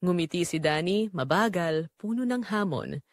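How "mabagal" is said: usual speaking voice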